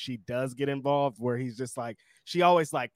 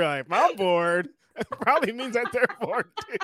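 laughing; 8 semitones higher, 5 dB louder than usual. Real, not acted. Quoting partner while laughing.

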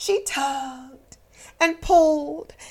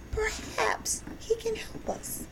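soft hushed hope; hopeful whisper; 8 semitones higher, 8 dB quieter than usual. Acted. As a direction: breathe the wish out. Clown hoping quietly.

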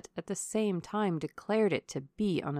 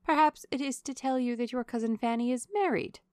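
higher-pitched nosy lady; lightly voiced, teasing guess; prying Mrs. Jennings; 5 semitones higher, 3 dB louder than usual. A narrator's reading. Lady guessing at the colonel's letter, high and prying.

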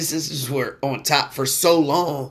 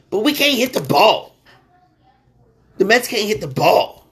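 frustrated rant; 5 semitones higher than usual. Real, not acted. Frustrated Mets fan rant.

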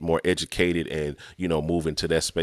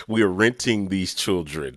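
slower, chuckling through the pun; laughing; 4 semitones higher than usual. Real, not acted. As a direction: laugh as you land the joke. Explaining his parents pun.

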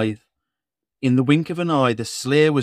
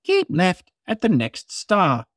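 higher, bossy satnav voice; bossy sat nav lady; 7 semitones higher than usual. Acted. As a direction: bark directions like a satnav lady. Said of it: "in a bossy lady's voice".

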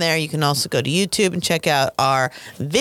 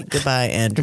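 much lower goodbye; deep voice; 6 semitones lower than usual. Acted. Cohost modelling the goodbye in a deep voice. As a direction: say it low and heavy. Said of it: "You're supposed to say".

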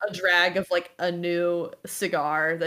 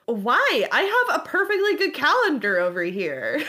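high, louder, baffled sister; higher-pitched, incredulous protest; sister Pilar; 7 semitones higher, 4 dB louder than usual. Acted. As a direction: question a sibling with puzzled indignation. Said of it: "just goes like".

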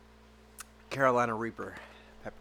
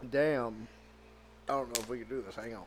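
ordinary delivery

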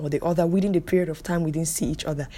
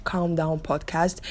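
usual speaking voice

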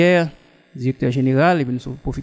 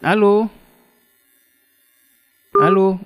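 to someone hard of hearing; 5 semitones higher, 5 dB louder than usual. Real, not acted. Caller can't hear the host.

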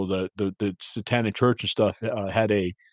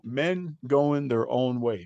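"men going their own way" said very slowly, word by word; slow repeat; 4 dB quieter, much slower than usual. Shown as a demonstration. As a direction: spell it out slowly for the listener. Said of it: "say that one more time".

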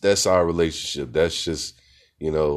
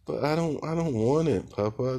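put-on high, timid little-girl voice; little daughter voice; 11 semitones higher than usual. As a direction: imitate a small girl refusing her food, much higher. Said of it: "she's like".

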